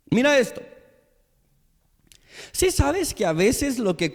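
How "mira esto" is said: ordinary talk, nothing marked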